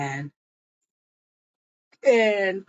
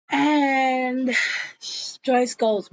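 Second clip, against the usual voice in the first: ordinary talk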